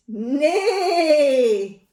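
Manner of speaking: loud, long, high goose pretending to be a horse; neigh with all her might. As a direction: belt out a big neigh. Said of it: "with all her might".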